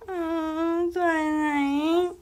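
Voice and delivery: high, whiny mock plea to the pet; high mock whine at the door; mock pleading.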